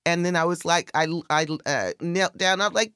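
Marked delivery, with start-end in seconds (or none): none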